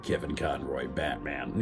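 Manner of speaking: deep, gruff caped-crusader growl; Kevin Conroy Batman voice. Retold or imitated. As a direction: drop low into a brooding Batman voice. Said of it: "I played".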